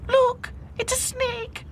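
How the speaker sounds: very high, slow terrified squeal; Lucy terrified of a snake; shrieking fright. A narrator's reading. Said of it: "Lucy gave a shriek".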